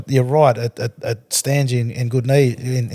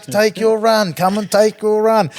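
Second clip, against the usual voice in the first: higher, mild retold yell, slowing; mock yell; 8 semitones higher, slower than usual. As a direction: taunt him to take his run. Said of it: "he just kept yelling out to me".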